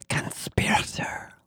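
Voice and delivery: slow, whispered reptilian hiss; hiss and gurgle. A narrator's reading. Lizard alien accuses narrator a second time, hissing gurgle. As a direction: draw it out in a soft gurgling whisper. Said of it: "a hiss and gurgle tucked into the syllables".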